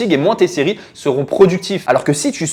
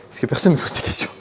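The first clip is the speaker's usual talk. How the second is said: aparté chuchoté; 3 semitones lower, 4 dB quieter than usual. Acted. Aparté ironique.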